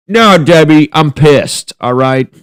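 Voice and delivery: high, loud mock yell at Debbie; furious shout. Grandpa yelling at robot girlfriend Debbie, furious shout.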